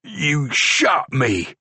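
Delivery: slow, dazed disbelief; stunned. A narrator's reading. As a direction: say it slowly, bewildered. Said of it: "he said".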